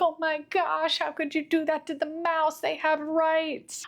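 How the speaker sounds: high-pitched mock outrage; outraged empaths. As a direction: imitate over-sensitive classmates pleading in a high voice. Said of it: "I can only imagine that people were like".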